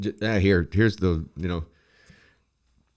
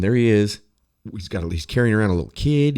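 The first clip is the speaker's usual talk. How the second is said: normal talking voice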